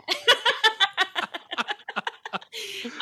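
long, loud, high laughter; big laugh. Real, not acted. Teacher laughing at her own story, big laugh. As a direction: burst out in a long real laugh.

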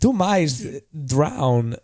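halting delivery with odd pauses; swooping, stop-start cadence; Christopher Walken. Acted. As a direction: speak in broken phrases, each peaking then dropping. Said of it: "let's hear Christopher Walken first".

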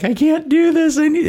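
hammed-up breakdown at the end of your rope; mock despairing whine. Runner texting the family chat for help, mock whimpering plea. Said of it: "texting the family group chat".

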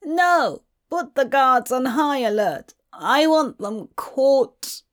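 put-on old aristocrat giving urgent orders; alarmed elderly man. A narrator's reading. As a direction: order it in alarm, drawing out the end.

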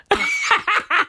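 brief high laugh; short amused giggle; high chuckle. Real, not acted. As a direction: chuckle at your own punchline. Laughs at own line.

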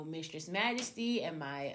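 usual speaking voice

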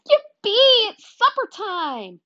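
high-pitched, drawn-out whoop of joy; gleeful high cheer; excited dog's cheer. Acted. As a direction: squeal it like an overjoyed dog. Dog cheers for supper.

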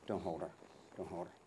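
quiet, low murmur to horse; soft murmur to horse. Real, not acted. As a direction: soothe the mare under your breath. Softly talking to the mare.

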